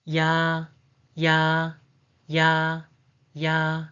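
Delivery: "ya" with a quick y-glide into a long, level ah; separate, drawn-out "ya" for learners to copy; vowel demo. Shown as a demonstration. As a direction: say "ya" evenly, holding the vowel on one pitch. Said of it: "The pronunciation is".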